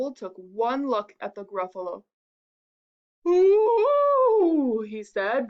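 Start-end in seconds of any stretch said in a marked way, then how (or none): 3.3-4.8 loud, high, long hoot of fright; drawn-out high cry of alarm; alarmed owl. Acted. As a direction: let out a startled owl hoot.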